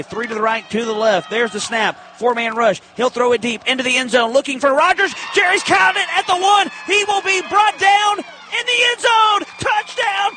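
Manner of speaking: normal talking voice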